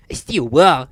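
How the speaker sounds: cocky, incredulous hero; sneering. A narrator's reading. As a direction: sneer at the obstacle. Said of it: "he sneered".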